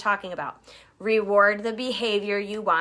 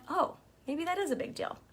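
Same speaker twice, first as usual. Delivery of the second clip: higher, softer surprised realization; dawning surprise; 6 semitones higher, 9 dB quieter than usual. Retold or imitated. Shy team member realizing a win matters, surprised.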